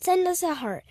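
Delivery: ordinary delivery, nothing marked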